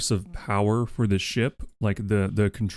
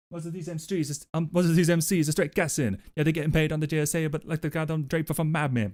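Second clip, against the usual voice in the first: mock London accent; 7 semitones higher than usual. Mock British slang rant.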